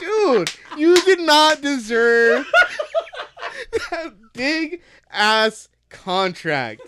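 high, slow, breaking into laughter mid sentence; laughing through it. Real, not acted. Telling the player he didn't deserve it, laughing. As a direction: try to say it but keep cracking up.

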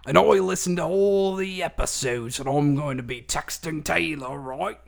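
hammed-up London accent; fake British accent. Pretending to be Georgina in a loud Cockney accent. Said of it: "talking in a nice accent".

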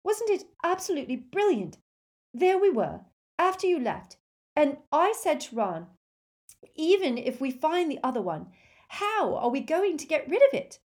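high, fast, excited rush; breathless excitement. Acted. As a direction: gush out the story breathlessly. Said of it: "said Hermione breathlessly".

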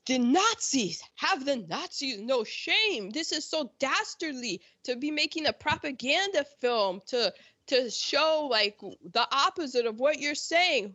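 high, theatrical moral indignation; mock outrage. Imitating appalled readers of Wikipedia.

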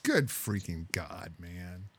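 slow, low, quiet disbelief; real weary dismay, trailing away; exasperated dismay. Real, not acted. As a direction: sigh the words out slowly and low. Dismayed reaction to driver's story.

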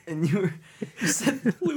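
high squeaky giggling protest; laughing. Real, not acted. Still laughing.

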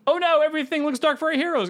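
high, hammed-up damsel alarm; mock dramatic gasp. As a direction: ham up the peril sarcastically. Mocking the film's dark turn.

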